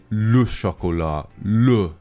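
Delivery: slow, nasal-free French mockery of a snooty local; mock French accent. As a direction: imitate a dismissive French speaker, slowly. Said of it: "And people would say".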